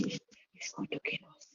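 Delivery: put-on a child's terrified whisper, barely breathed; imitating a frightened little girl, whispered. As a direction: whisper it like you're scared he'll hear. Frightened child repeating husband's threat.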